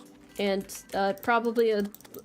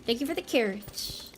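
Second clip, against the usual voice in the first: small soft thanks; 5 dB quieter than usual. Pretend. Thanking a viewer for carrots, playfully soft. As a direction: drop to a soft aside. Same thanks repeated softly.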